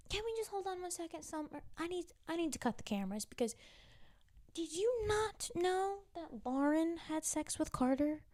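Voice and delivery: high, fast, hushed disbelief, then a rising high question; incredulous. Real, not acted. Recapper halting the scene to quiz Summer, incredulous and high. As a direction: stop the show in disbelief.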